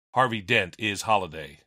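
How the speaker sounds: ordinary talk, nothing marked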